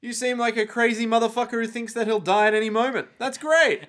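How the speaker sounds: high-pitched, hammed-up enthusiasm; mock delight. Acts out the delighted crazed fighter.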